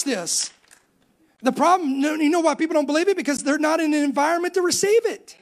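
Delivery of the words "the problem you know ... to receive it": higher, emphatic pulpit delivery; fired-up preaching. Real, not acted. Preacher urging the church to respond to true power, fired-up exhortation. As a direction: drive it home to the congregation, stretching the words.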